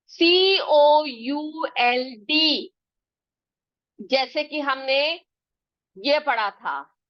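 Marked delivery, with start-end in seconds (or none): 0.1-2.7 letters spelled out very slowly, one by one; slow spelling. Shown as a demonstration. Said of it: "एक और word आ गया". (अक्षर-अक्षर बहुत धीरे बोलना)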